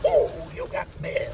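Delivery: high mock announcer chirp on "you got mail"; AOL mail voice. Recalling AOL, imitates the chirpy mail alert. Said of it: "I go".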